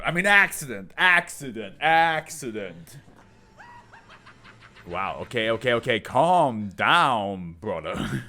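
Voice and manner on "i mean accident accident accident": insistent repetition. Retold or imitated. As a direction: keep hammering the word. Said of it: "He's gonna be like".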